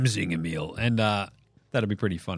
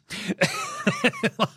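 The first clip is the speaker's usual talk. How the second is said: high burst of laughter; 3 dB quieter than usual. Real, not acted. Big laugh after quote.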